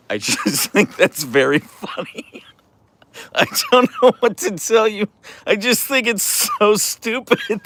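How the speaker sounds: laughing through the words. Real, not acted. Streamer laughs at her own silly video idea. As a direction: giggle as you speak.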